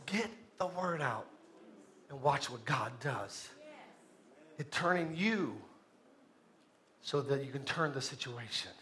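very soft, low and measured; hushed preaching close. Real, not acted. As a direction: bring it down low and let each phrase settle.

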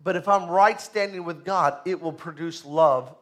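loud, rising sermon delivery; full-force preaching. Acted. Pastor urging the church to grow, preaching loud. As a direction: drive it home to the congregation.

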